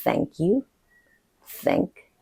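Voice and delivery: "thank" with a clear English TH; TH demonstration. Shown as a demonstration. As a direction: set out "thank" as a slow, separate example. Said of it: "T H sound does not exist".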